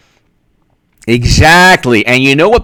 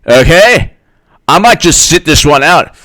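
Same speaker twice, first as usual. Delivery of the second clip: normal talking voice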